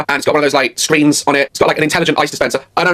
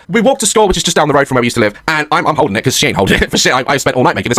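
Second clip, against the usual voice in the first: ordinary delivery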